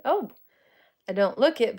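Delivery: normal talking voice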